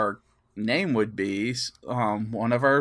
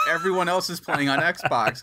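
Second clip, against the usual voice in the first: said through laughter, higher; laughing at the absurdity; giggly disbelief; 6 semitones higher than usual. Real, not acted. Laughing at own predicament.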